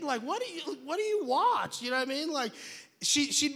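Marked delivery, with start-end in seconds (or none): 0.0-1.8 incredulous dad. Retold or imitated. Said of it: "I'd be like".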